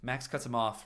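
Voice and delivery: plain reading voice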